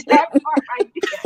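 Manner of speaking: laughing through the words. Real, not acted. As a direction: repeat it while cracking up.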